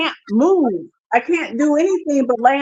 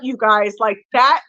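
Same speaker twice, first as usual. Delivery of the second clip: ordinary delivery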